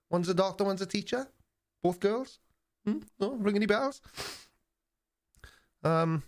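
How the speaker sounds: high, eager questions, lilting upward; mock-naive pom. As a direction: play the hopeful tourist, pitched well up. Teasing Australian viewers as naive pom.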